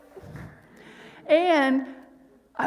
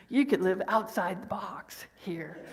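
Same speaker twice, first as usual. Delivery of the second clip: whisper; 4 semitones lower, 4 dB quieter than usual. Real, not acted. Aside to congregation, whispered.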